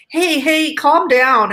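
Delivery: high, loud mock of a man saying hey, hey, calm down; mocking patronizing man. Woman mocking the man who told her to calm down, patronizing. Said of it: "I've been told that by men before".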